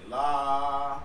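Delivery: slow, stretched la; drawn-out la. Shown as a demonstration. Teacher slowly repeating the sung la. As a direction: draw the la out slowly. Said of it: "Is that your first note?".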